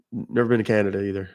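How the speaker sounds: usual speaking voice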